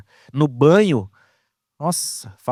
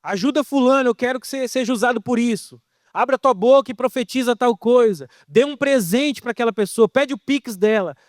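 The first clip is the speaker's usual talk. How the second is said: God yelling; 8 semitones higher than usual. Retold or imitated. God yelling commands from far away, mock shout. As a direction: call out orders like a distant God. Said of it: "Aí ele grita".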